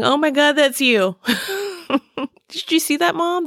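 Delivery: high-pitched giggly imitation of her laughing child; laughing through the words like a delighted teen; laughing retold kid. Imitating her oldest child bursting out laughing at a driver, high and giggly. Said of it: "totally busted out laughing, saying".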